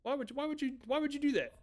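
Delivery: high, whiny exasperated complaint. Real, not acted. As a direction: whine at chat in disbelief. Exasperated at chat spoilers.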